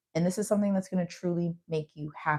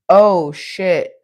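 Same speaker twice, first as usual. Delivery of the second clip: surprised; 11 dB louder than usual. Real, not acted. Sudden reaction to a card.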